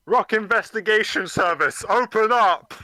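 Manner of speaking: loud commanding call; shouting. Acted. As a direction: bang on the door and shout. Bursting in, calling out.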